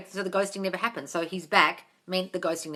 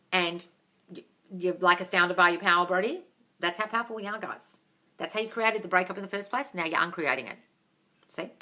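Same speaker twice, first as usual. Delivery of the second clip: usual speaking voice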